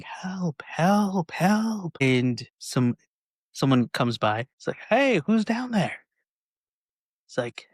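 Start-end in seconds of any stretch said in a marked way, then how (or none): 0.0-2.0 rising cries of help, each higher; mock yell for help. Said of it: "They're yelling". 4.9-6.0 high called-out question; calling down. Retold or imitated. Passerby calling down manhole.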